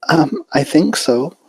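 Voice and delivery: shy little pony voice; gentle hesitant filly; Fluttershy voice. A narrator's reading. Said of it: "she said".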